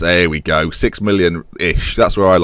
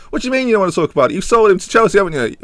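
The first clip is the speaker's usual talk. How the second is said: accusing squad player; 11 semitones higher, much faster than usual. Acted.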